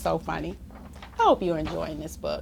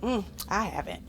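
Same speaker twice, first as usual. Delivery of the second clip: ordinary delivery, nothing marked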